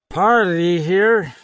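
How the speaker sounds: put-on high, slow, slurred drunken voice; drunk party guest. A narrator's reading. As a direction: play a grinning drunk. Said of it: "he explained in English".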